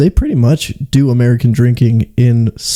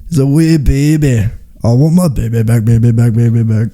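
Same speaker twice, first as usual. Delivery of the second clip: hammed-up Scottish movie character; broad comic Scots, half-chanted; Scottish accent. Friend quoting Austin Powers, broad Scottish accent. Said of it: "used to watch Austin Powers".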